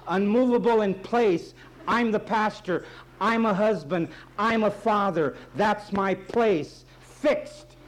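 high, loud pulpit cadence; preacher hammering on fixed in time and place; full-force preaching. Acted. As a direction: drive each word home from the pulpit.

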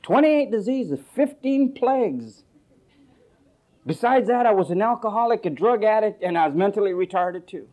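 high, loud mock bragging; boastful testimony. Preacher mimics a man bragging of diseases, mock boastful. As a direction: proudly pile on the afflictions, hammed-up. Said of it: "I like to boast about".

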